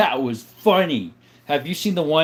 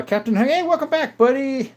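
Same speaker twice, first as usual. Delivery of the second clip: cheery greeting; 11 semitones higher than usual. Real, not acted. Greeting returning chatter.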